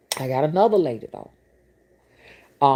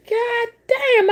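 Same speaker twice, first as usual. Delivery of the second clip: very high and loud, floored by what she hears; loud amazed exclaim; 6 dB louder than usual. Retold or imitated. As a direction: blurt it out high and loud in shock. Reacting to client bragging.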